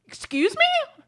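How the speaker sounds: high squeaked disbelief; incredulous excuse me. Real, not acted. Reacting to grandma's plate remark, high incredulous squeak. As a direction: shoot up high in mock outrage.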